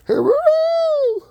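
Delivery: drawn-out, high, loud "Hey, Roo-roo"; long falsetto farmyard call; slow high chicken call. Real, not acted. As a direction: stretch the call out high to the far hens. Calling the chickens in.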